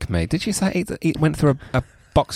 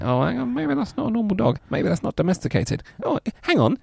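puzzled pet owner; 10 semitones higher, 3 dB quieter than usual. Acted. Acting an owner's dawning suspicion.

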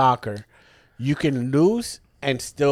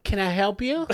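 high, rising mock greeting; put-on higher voice, lilting up at the end; imitating co-host's voice; 6 semitones higher, 4 dB quieter than usual. Doing the co-host as shop owner greeting a stranger, mock polite. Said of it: "I gotta do your voice".

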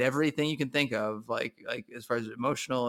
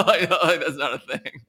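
laughing; 3 semitones higher, 8 dB louder than usual. Real, not acted. Laughing at own joke.

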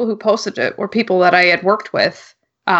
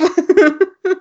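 high cackling villain laugh; evil villain laugh; 11 semitones higher than usual. Pretend. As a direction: cackle like a mustache twirling villain. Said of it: "It's my evil villain laugh".

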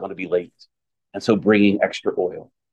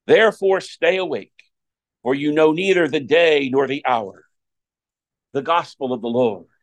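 solemn proclaimed scripture reading; Gospel reading; 4 semitones higher than usual. Acted. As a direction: read it out like a lector at Mass.

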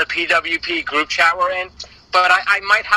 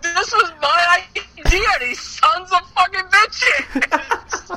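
mock indignant rant; 11 semitones higher, much slower than usual. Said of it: "I'm like".

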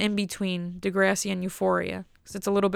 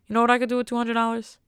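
ordinary delivery

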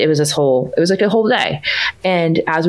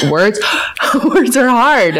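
laughing; 8 semitones higher, slower than usual. Real, not acted. Guest laughing along with host about words, laughing. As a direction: giggle out the words as you agree.

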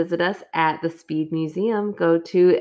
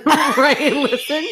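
laughing high "Right, listen"; amused laughing agreement; 9 semitones higher, 5 dB louder, much slower than usual. Real, not acted. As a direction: giggle through the words. Laughing correction of plan.

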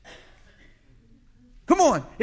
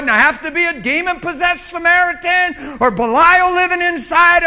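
high, loud, exasperated rant; mock-furious Jesus venting, half laughing; fed-up Jesus; 8 semitones higher, 10 dB louder than usual. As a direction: shout it like a worn-out savior at the end of his rope. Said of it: "picture Jesus just dropping the cross saying".

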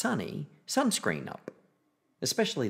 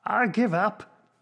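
slow, higher-pitched give-up call; granddad giving up; 10 semitones higher, 5 dB louder, slower than usual. A narrator's reading. Granddad ends hide-and-seek, slow loud call. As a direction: call it out slowly, louder, giving up. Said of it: "As soon as your granddad says".

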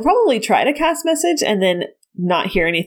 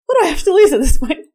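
higher, whimpering character voice; tearful resignation; 5 semitones higher, 4 dB louder than usual. Acted. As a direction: give in with an acted near-sob. In-character resignation while hurt.

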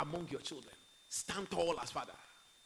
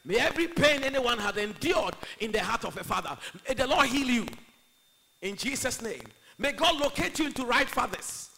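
very loud, raised preacher's blessing; forceful prayer; 6 semitones higher, 14 dB louder than usual. Real, not acted. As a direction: proclaim the blessing over the congregation. Prays over congregation at full force.

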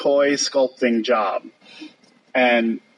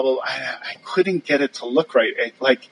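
ordinary delivery

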